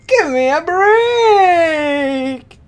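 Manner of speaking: high, slow drawn-out cry; exasperated villain wail. Acted. Villain falling in his own pit, high and drawn out. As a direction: groan at your own misfortune.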